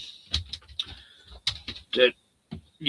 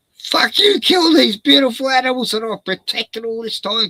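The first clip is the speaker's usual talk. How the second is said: higher, much louder, raging yell; furious shout; 10 semitones higher, 8 dB louder than usual. Retold or imitated. Cain screams at Abel for killing animals, furious. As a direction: scream it at the brother who mocked you.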